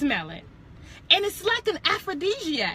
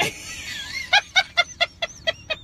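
a high, breathy burst of laughter; high giggle. Real, not acted. A woman cracking herself up on her podcast, high giggle. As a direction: laugh at her own joke.

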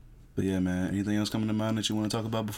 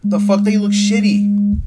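drawn-out loud voice; 10 semitones higher, 13 dB louder, slower than usual. Pretend. Exaggerated loud slow remark.

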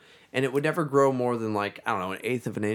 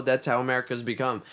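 ordinary talk

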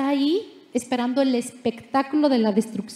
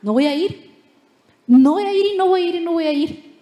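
put-on stubborn child refusal; 4 semitones higher, 4 dB louder, faster than usual. Jonah refuses over and over.

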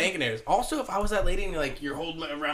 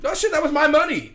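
mock indignation; 11 semitones higher, 6 dB louder than usual. Acts indignant robbed hostage.

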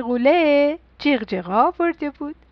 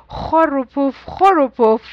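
mock snore, a touch louder; snoring; 4 dB louder than usual. A narrator's reading. Papa snoring as he falls asleep, mock. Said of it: "خوابید و گفت".

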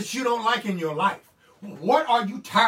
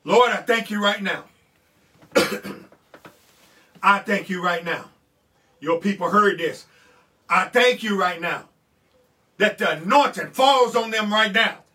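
earnest spoken prayer to the Lord; 6 dB louder than usual. Acted. Pastor praying over the congregation, earnestly. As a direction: pray over the people.

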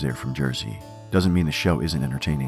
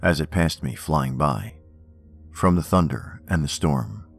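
poem reading. Acted. Reads Poe poems aloud.

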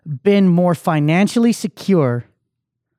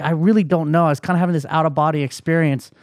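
usual speaking voice

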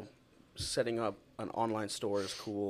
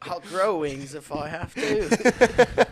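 higher, much louder, slow boast; loud daredevil voice; 7 semitones higher, 14 dB louder, much slower than usual. Retold or imitated. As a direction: belt it like a fearless daredevil. Joining the daredevil bit.

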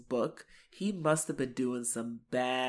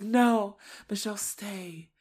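mock lover's quarrel; 6 semitones higher, slower than usual. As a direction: imitate a lover begging. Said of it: "like, a lover's quarrel".

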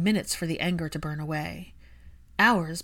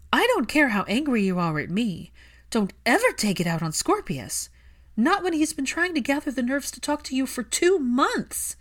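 louder, rising fury; confronting Draco angrily, climbing higher; angry confrontation; 7 semitones higher, 4 dB louder than usual. Acted. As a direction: scold him hard for hurting the boy.

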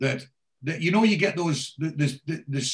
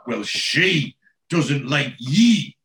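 put-on slow blunt kid; 6 dB louder, much slower than usual. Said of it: "he goes".

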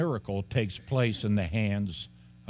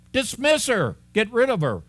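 mock impatient disciples; 10 semitones higher, 4 dB louder than usual. Acts disciples shooing woman away.

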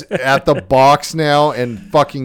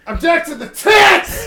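high, loud, thrilled yell; excited shout; 3 dB louder than usual. Real, not acted. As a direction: scream it with pure glee. Thrilled about movie news.

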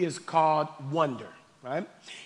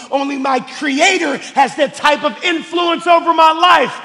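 raised, emphatic preacher's voice; forceful preaching; 8 semitones higher, 12 dB louder than usual. Real, not acted. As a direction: preach it out to the whole room.

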